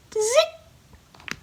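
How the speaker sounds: very high tiny "zick"; tiny high bird squeak; hummingbird call. Shown as a demonstration. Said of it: "It sounds like this".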